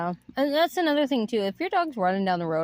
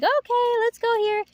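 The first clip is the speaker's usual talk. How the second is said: much higher cheerful call; happy voice; 13 semitones higher than usual. Shown as a demonstration. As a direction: coax the dog in a bright high voice. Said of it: "keep yourself happy".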